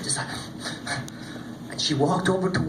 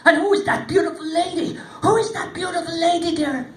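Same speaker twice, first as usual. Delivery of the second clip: curious warrior; 11 semitones higher, 3 dB louder than usual. Acted. Warrior asks about lady.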